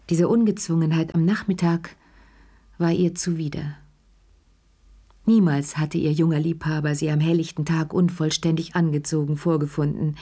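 ordinary delivery

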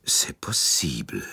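low, slow, half-whispered admission; reluctant concession. A narrator's reading. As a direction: concede it grudgingly, dragging the words. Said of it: "gab Monsieur Bouc widerwillig zu".

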